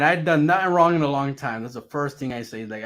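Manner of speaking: ordinary delivery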